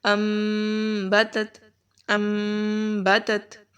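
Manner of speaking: ام بتت drawn out very slowly, M hummed in the nose; slow nasal ghunna. Shown as a demonstration. Teacher models the ghunna slowly for students. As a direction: stretch the M of ام بتت through the nose. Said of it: "نون کی آواز کو میم کے ساتھ بدل دیں گے".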